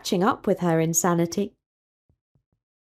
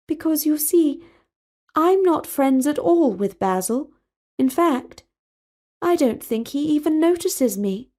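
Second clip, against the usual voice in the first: put-on higher, poised young princess, earnest about magic; princess Talia's voice; 6 semitones higher than usual. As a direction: play the serene princess, raised pitch. Talia confides about Basil.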